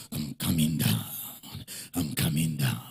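calmed God. Pretend. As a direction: let the voice sink as God calms down. God calmed, voiced softly.

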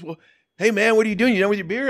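high, loud buddy invite; friendly rocker. Retold or imitated. Rock singer inviting a fan along, high and loud. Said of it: "He goes".

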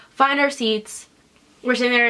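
ordinary talk, nothing marked

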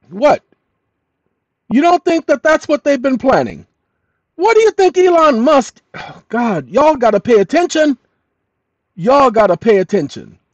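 high, loud, urgent pleading; worked up shout. Real, not acted. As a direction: shout it out, almost shrieking. Building outburst to viewers.

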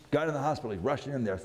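normal talking voice

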